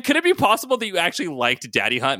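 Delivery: high, incredulous yell. A narrator's reading. Maddie accuses Ryan of liking the show, yelling. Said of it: "Maddie yelled".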